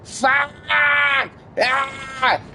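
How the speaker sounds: high yelled curses then a cry; imitated screaming. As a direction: scream it like the guy in the game. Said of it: "He's screaming".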